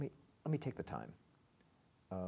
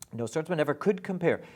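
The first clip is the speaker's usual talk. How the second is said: EVER lifted high and loud, faster; stress on EVER; 7 semitones higher, 10 dB louder, faster than usual. Shown as a demonstration. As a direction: lean on ever, not could. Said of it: "I want to point out".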